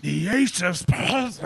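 raspy rocker with a cold. Retold or imitated. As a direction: gravel it out like a sick rocker. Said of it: "a motorhead with a cold".

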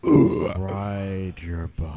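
drawn-out deliberate command; very slow. Pretend. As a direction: stretch every word slowly.

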